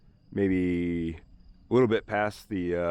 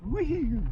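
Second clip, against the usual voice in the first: excited whoop. Real, not acted. Truck climbing rough trail.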